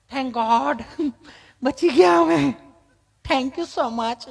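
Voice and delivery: high, drawn-out gushing thanks; put-on city woman, stretching the last words; mimicked relieved tourist. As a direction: gush with comic relief.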